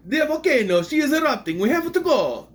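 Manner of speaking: high, loud hammed-up Italian accent; panicked mock-Italian wail. Movie fan parodying Pompeii citizens fleeing, hammed-up Italian accent. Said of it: "speaking with an Italian accent".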